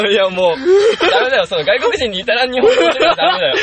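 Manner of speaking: loud, high, helpless laughing protest; talking through laughter. Real, not acted. Guest warning friends off teaching foreigners, laughing hard. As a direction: protest while still cracking up. Still cracking up over own story.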